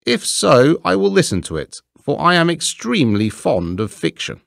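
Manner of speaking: high, pleased and self-satisfied; graciously agree, delighted with yourself; pleased rat condescending to listen; eager vain rat. Acted.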